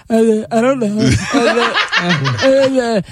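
dopey, halting mock-boyfriend voice; boyfriend as dim mumbler. Girlfriend showing how her boyfriend sounds, mock dopey. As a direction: mumble vacantly, all uhs. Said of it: "this is how my boyfriend sounds to me".

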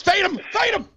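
high, loud cry; urgent shouted order. Real, not acted. Host to producer about an unwanted caller, high urgent shout. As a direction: call out a command to cut the caller off.

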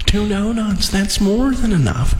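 ordinary delivery

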